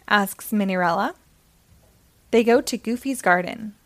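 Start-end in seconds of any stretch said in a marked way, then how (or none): none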